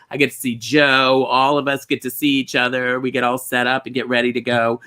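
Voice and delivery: ordinary delivery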